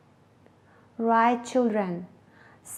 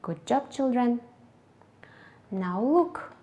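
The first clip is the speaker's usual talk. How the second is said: ordinary delivery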